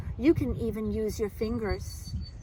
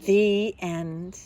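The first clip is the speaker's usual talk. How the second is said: lively, expressive picture-book reading; reading to children. Acted. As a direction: read it like storytime for little kids.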